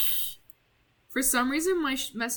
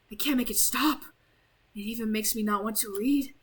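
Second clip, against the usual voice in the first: usual speaking voice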